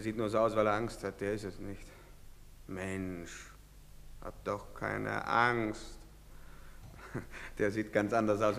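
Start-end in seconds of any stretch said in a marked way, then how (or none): 2.7-6.0 soothing. Acted. Calming a frightened man.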